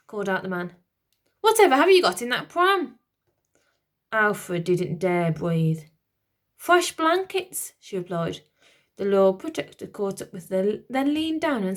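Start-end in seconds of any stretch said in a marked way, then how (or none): 1.4-3.0 suspicious demand. Acted. Lord Protector suspicious. 6.5-7.7 high, slow fake innocence; nervous nanny. Nanny bluffing.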